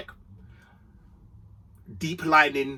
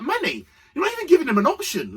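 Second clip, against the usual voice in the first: exasperated call; 11 semitones higher, 4 dB louder, faster than usual. Retold or imitated. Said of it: "I was like".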